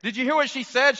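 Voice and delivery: normal talking voice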